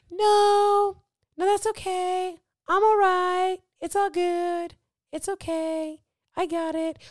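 mock polite refusal. Woman refusing a friend's help, mock high and cheery.

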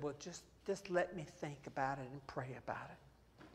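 low, soft and composed; calm, measured reply. Retold or imitated. Pastor calmly answering the coaching offer, quiet and measured. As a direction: play it cool and unhurried. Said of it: "I took that very calmly".

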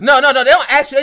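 high, loud objection; loud protest. Real, not acted. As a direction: cut in loudly to disagree. Loud protest cutting into the debate.

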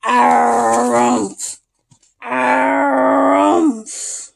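drawn-out grumpy rumph; bison bellow. Acted. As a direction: bellow it out like a sleepy old bison. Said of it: "and louder".

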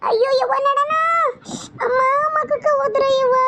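high, crying wail to her mother. Acted. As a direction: wail out in pain and tears. Wife wails after being hit. (ಅತ್ತು ಗೋಳಾಡುವ ಕೂಗು)

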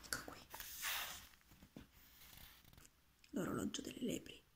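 soft whisper, leaning in; whispered aside. A narrator's reading. Narrator showing the listener the hare clock, whispered. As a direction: hush it like a secret.

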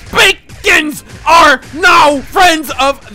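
loud, drawn-out and high; slow shout. Real, not acted. Gamer yelling slowly at the bacon in the game. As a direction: stretch the words out in a big yell.

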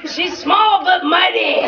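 big, slow, proud proclamation; bold announcer shout. Retold or imitated. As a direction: announce it like a hype intro.

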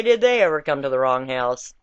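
usual speaking voice

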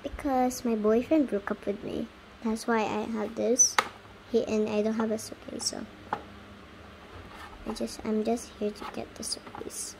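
soft, low-key mumbled explanation; downcast pretend voice. Girl explains her breakup to a stranger, subdued and soft. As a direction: play a heartbroken doll, quietly. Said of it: "why is your voice speak like that".

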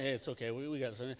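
calm her down quietly; hushed reassurance. Retold or imitated. Calming scared wife at border.

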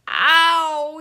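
sharp loud cry of pain. A narrator's reading. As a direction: yelp as he hits the ice. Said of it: "he cried".

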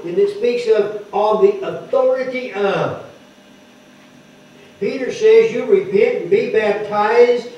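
a preacher driving home scripture; preaching. Acted. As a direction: preach it to the congregation with conviction. Preaching on the authority of Jesus.

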